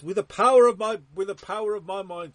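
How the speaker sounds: high, loud booming incantation; grand spellcaster. Pretend. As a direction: proclaim it like a wizard.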